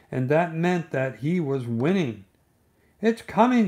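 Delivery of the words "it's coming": high, eager call; excited Pooh. A narrator's reading. Pooh at the bridge rail, excitedly spotting the stick. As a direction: watch the stick come out. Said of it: "said Pooh".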